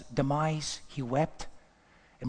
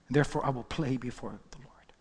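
whisper; 3 semitones lower, 4 dB quieter than usual. Acted. David's reply sinks to hush.

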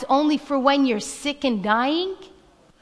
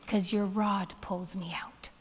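breathy whispered aside, much softer; 7 semitones lower, 13 dB quieter than usual. Acted. Pastor whispering an aside while reciting the psalm, whispered.

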